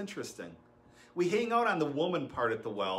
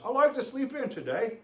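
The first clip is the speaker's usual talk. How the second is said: hammed-up British accent, pitched higher and louder; mock British; 10 semitones higher, 5 dB louder than usual. Shown as a demonstration. Pastor joking about sleeping in, in a mock British accent. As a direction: play up a posh British accent for laughs. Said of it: "sounding a little British".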